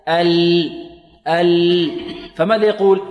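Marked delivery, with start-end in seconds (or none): 0.1-1.9 ال held on a ringing, voiced ل; voiced lam. Shown as a demonstration. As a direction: let the vocal cords buzz through the ل of ال. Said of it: "يهتز معها الوتران الصوتيان".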